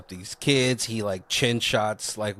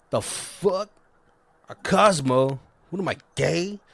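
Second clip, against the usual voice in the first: a hint of a bro voice, higher; mock offended jock; 6 semitones higher than usual. Jocks getting sent Cosmos, lightly mocking offended bro. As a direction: lightly huff like an insulted jock.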